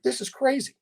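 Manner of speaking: incredulous, pitched well up; retold disbelief. Defendant reacting to strangers testifying, in high-pitched disbelief. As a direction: replay the bewilderment in a high voice.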